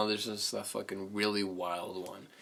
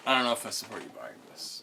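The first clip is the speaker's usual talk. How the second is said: quicker repeat; faster than usual. Real, not acted. Repeating doubts to a friend buying a game, quicker. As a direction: say it over in a faster run.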